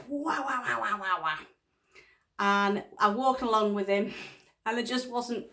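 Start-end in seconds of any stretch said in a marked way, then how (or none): 0.1-1.4 mock ranting noises sliding down in pitch; hot-headed rant. Wife imitating husband sounding off on a beach walk, mock hot-headed rant. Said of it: "hot-headed".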